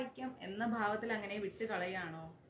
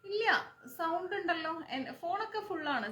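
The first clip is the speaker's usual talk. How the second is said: raised voice; 8 semitones higher than usual. Real, not acted. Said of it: "ഇതിൽ കൂടുതൽ ഞാൻ എങ്ങനെയാ ശബ്ദം എടുത്ത് വിടുന്ന".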